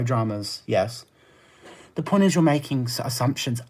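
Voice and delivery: usual speaking voice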